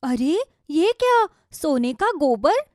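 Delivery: wife's incredulous echo, rising at the end; astonished question. Acted. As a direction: ask "सोने का गोबर" in disbelief, lifting the ending. Wife reacts to the discovery.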